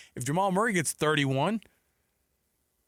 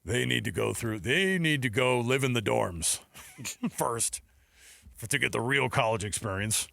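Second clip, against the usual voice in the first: put-on old school analyst; 3 semitones lower than usual. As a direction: lay down the rules like a mock old head. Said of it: "you see how old school J J is?".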